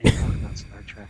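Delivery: hushed, unvoiced add-on; whispered jab. Smart speaker piling on its owner, whispered. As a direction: chip in with a whisper.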